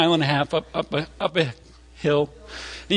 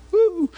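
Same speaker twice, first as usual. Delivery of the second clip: high-pitched "woo" of onlookers; excited whoop; 3 dB louder than usual. Retold or imitated. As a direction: give a short, high crowd whoop. Crowd excited at spotting wildlife.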